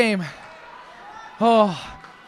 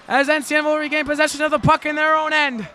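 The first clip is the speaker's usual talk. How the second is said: ordinary delivery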